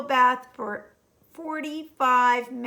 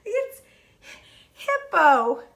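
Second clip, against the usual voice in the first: laughing out the name; 4 semitones higher than usual. Acted. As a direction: struggle through laughter to say the name. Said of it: "gasped the rhinoceros".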